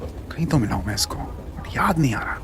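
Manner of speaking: soft half-whispered thought; muttering to himself. Acted. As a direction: murmur it privately. Inner thought about stranger.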